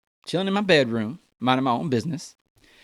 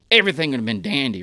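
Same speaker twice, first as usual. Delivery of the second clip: ordinary delivery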